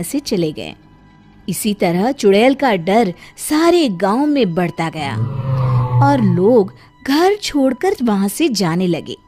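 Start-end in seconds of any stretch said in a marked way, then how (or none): none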